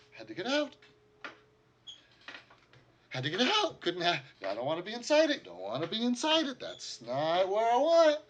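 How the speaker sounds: high, loud, desperate cries; panicked pleading. Acted. Survivor panics to the party, high and loud. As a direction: beg to stay out of there, terrified.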